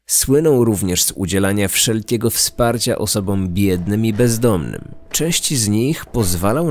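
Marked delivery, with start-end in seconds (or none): none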